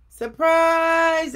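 loud, high shout of surprise; family shouting surprise for daddy; cheerful shout. Acted. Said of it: "everybody said".

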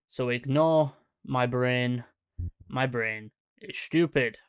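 normal talking voice

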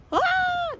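startled ah. Acted. Animal startled, high exclamation.

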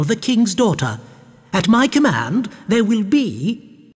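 high-pitched, imperious plotting; scheming baroness. A narrator's reading. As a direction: lay out the plot to a servant. Baroness instructs magician.

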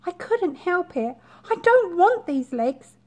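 high, pleading tadpole voice. Acted. Tadpole pleading after begging.

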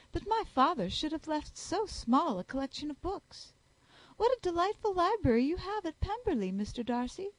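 high, affected voice of a flattering lady; fawning Miss Bingley. Acted. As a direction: flatter him shamelessly.

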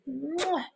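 soft blown mwah; kiss sound. Acted. Teacher blows kiss to children, soft mwah. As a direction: blow a kiss gently.

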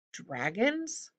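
hushed, horrified echo with a long rise; horrified question. A narrator's reading. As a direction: repeat it softly in horror, pitch climbing. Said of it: "Jared repeated in horror".

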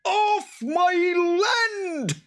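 very high, loud, drawn-out shriek; furious boggart screech. A narrator's reading. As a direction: shriek it slowly like an enraged creature. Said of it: "he shrieked".

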